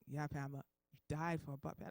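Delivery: deep, low-pitched verdict; God. Acted. Deep voice confirms the patient's death, grave. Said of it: "I hear this deep voice".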